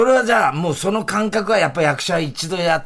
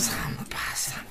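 whispered, barely voiced repetition; hushed echo; 13 dB quieter than usual. Real, not acted. Co-host echoing the guest's startling figure, hushed. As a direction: murmur it under your breath in wonder.

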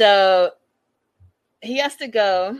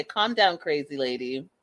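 usual speaking voice

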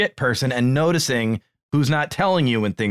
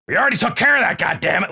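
mock irate boss, jumping high then dropping; retold exasperated rebuke; imitated angry snap; 7 semitones higher than usual. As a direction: snap back as the boss, pitched up. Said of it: "he snapped at him immediately".

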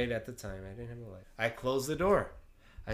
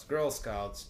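normal talking voice

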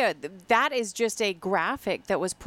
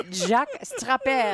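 put-on French-Canadian accent; 4 semitones higher than usual. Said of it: "You need to have a good Canadian French accent".

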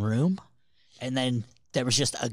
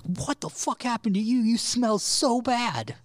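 much higher, slow mocking friends' voice; mock shocked friends; 13 semitones higher, 3 dB louder, slower than usual. Telling the dress story, friends' high mock reaction. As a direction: squeal it high and stretched.

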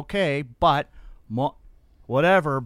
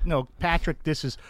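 normal talking voice

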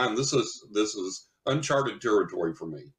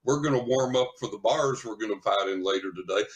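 ordinary delivery

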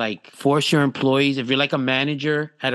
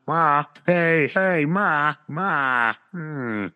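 lightly voiced whiny Ray Romano calling ma; a hint of Ray Romano moaning; mock Ray Romano calling for ma; Ray Romano impression; much slower than usual. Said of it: "I got Ray Romano".

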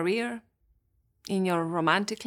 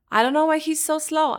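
mimicking a frustrated client; 6 semitones higher, 5 dB louder than usual. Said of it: "that's what I hear".

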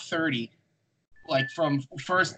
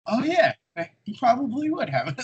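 acted reaction; 11 semitones higher than usual. Retelling own reaction to Walter's accent, high-pitched. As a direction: pipe up high in mock realization.

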